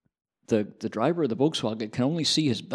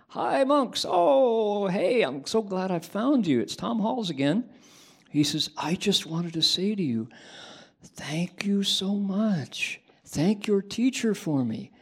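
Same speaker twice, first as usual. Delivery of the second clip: much higher, bubbling gratitude; excited thanks; 8 semitones higher than usual. Retold or imitated. Reporter thrilled to find the monks again, thanking them excitedly. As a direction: gush with relieved joy.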